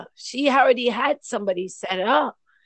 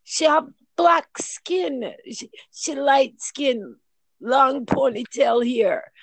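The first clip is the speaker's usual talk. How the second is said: Jamaican grandfather; 5 semitones higher, 4 dB louder than usual. Retold or imitated. Jamaican grandfather quoted in patois.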